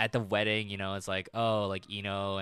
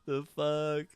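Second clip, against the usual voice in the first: laughing amazement; 5 semitones higher, much slower than usual. Retold or imitated. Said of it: "I was like".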